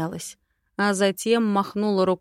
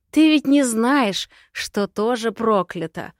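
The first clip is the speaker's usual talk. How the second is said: smiling taunt; 4 semitones higher, 5 dB louder than usual. A narrator's reading. Witch tells the orphan of her curse, gloating taunt. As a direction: tease with a nasty secret. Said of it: "она улыбнулась".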